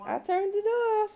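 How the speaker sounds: high, sweet sing-song reassurance. Real, not acted. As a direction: lift it way up to coax a smile. Repeating to baby.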